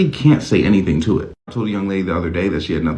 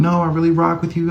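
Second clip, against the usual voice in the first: 1 reassuring partner; 8 semitones higher than usual. Retold or imitated. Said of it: "They're gonna be like".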